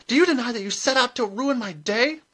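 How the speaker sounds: high, loud outrage; shrill, enraged challenge; furious accusation. A narrator's reading. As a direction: demand it in a shrill, angry shout. Furious rival accuses Granny.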